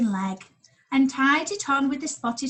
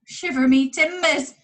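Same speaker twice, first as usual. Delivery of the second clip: pirate exclamation; 5 semitones higher, 6 dB louder than usual. Acted. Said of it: "he exclaimed".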